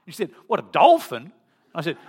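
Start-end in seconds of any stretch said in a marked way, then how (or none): 0.5-1.3 loud mock call back; shouted query. Surfing wife mishearing her husband on shore, loud puzzled shout. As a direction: holler a puzzled question over the waves.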